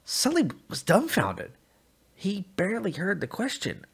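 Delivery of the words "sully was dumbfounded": ordinary delivery, nothing marked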